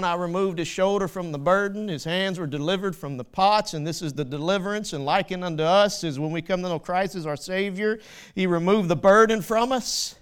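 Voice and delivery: loud, rising sermon voice; preaching at full force. Acted. Preacher to congregation, loud full-force preaching. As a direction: drive it home to the congregation.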